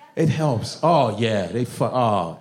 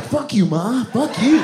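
put-on high, defiant child voice; mock kid yell, rising at the end; bratty little kid; 8 semitones higher, 4 dB louder, slower than usual. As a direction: sass your mother like a small brat. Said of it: "And the little kid's like".

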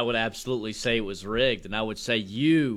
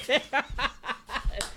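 laugh. Real, not acted. Co-host laughing at the bit.

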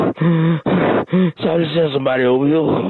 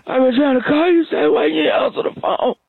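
high strained whine; 9 semitones higher than usual. Acted. Dying man complains he wasn't answered.

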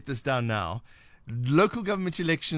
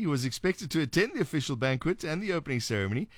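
ordinary talk, nothing marked

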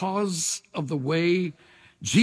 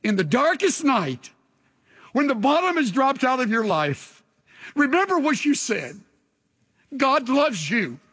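impassioned exhortation; 6 semitones higher than usual. Acted. Preacher exhorting at the climax.

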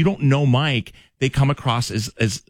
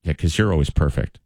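sarcastic; 6 semitones lower than usual. Shown as a demonstration. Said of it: "it is the tone".

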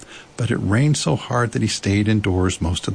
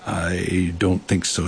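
slow, considered owl; grave, thoughtful; 3 semitones lower, slower than usual. A narrator's reading. Owl weighs up carrying Piglet, gravely. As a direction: draw it out ponderously. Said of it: "after grave thought".

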